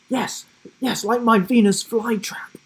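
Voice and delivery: put-on high, excited boy voice; eager boy. A narrator's reading. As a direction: nod along eagerly. Boy nods vigorously.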